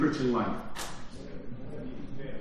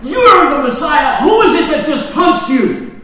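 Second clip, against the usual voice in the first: loud jeering mock taunt, high and shouted; mocking tormentors; 9 semitones higher, 13 dB louder than usual. Preacher voicing soldiers taunting Jesus, loud jeering shout. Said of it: "and they said".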